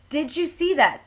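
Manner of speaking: mild storybook yell; read-aloud yell. A narrator's reading. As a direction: call it out like a startled driver. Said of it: "Tony yelled".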